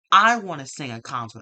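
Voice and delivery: loud mock wrestler demand; mock shout. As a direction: yell it like crashing the stage. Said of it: "comes out and be like".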